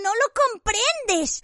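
high, slow whimper. A narrator's reading. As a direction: moan it out in self-pity. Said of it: "gimió Pettigrew".

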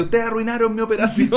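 mock indignant protest, pitched high, through a laugh; playing the offended undercover agent. Podcaster mocks a caught man as offended agent, laughing. Mocking predator excuse.

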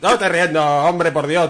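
high, loud and indignant; loud protest. Acted. Friend accused of laughing at séance, loud protest. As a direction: protest your innocence at full volume.

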